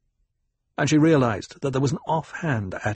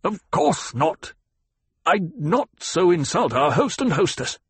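pitched-up, stiffly formal backing down; stiff denial; 7 semitones higher, 3 dB louder than usual. Acted. Frightened banker backs down before the ecologist, stiffly. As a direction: deny it stiffly, word by word. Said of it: "spoke stiffly".

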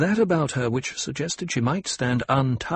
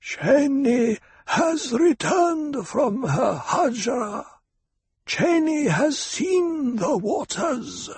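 high, slow, harsh crone voice; an old priestess intoning through a horn; amplified harsh whisper; much slower than usual. A narrator's reading. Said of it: "an amplified whisper, harsh and penetrating".

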